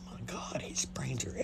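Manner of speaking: very soft, whispered fascination; secret awed whisper. Retold or imitated. Still fascinated while comforting a friend, hushed whisper. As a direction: whisper it aside so she won't hear.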